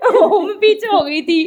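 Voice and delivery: slow words through real laughter; laugh-choked excuse. Real, not acted. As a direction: talk while still giggling. Still laughing after getting caught.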